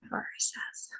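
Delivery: breathy whispered repeat, unvoiced; whispered echo. Shown as a demonstration. Meditation teacher echoing her phrase in a whisper. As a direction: whisper it back gently as an echo. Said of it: "So a radical act of love".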